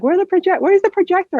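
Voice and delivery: high, puzzled where's the projector; coworkers searching. Retold or imitated. Coworkers looking for the missing projector, high puzzled voice. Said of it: "people were like".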